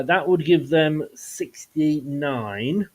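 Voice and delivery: slow, careful delivery of a mouthful; deliberately slow. Real, not acted. Host working out the league table live, deliberately slow. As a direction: spell the figure out slowly. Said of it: "I had to say that slowly".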